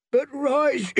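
put-on high, strained voice of a half-drowned boy; pained, choking speech. A narrator's reading. Half-drowned boy answers the priest's ritual, high and pained. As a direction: force each word out painfully, high and thin. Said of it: "Every word was bought with pain".

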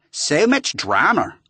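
a bad Australian accent, drawn out; an Australian computer voice mocking the drama. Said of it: "the Australian voice said again".

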